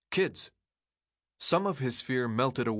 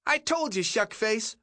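put-on much higher shrill kid voice; 12 semitones higher, 3 dB louder than usual. A narrator's reading. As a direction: pipe it up shrill and teasing. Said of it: "a shrill voice responded".

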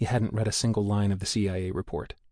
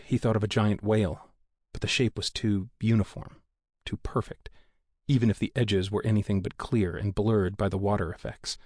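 ordinary delivery, nothing marked

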